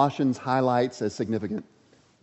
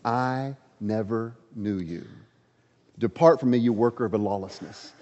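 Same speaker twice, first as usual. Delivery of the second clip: Jesus rejecting them; 5 semitones lower, 5 dB quieter than usual. Retold or imitated. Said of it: "Jesus' response is".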